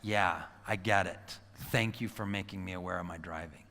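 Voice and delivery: low, soft acceptance; changed, calmer demeanor. Retold or imitated. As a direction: answer an angry driver quietly and humbly. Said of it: "my demeanor changes in that minute".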